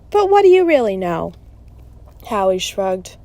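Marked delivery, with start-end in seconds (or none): 0.1-1.3 loud, high challenge to a friend; blurted, doubting retort. Acted. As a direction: throw the question out sharply, voice raised. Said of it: "Eddie blurted".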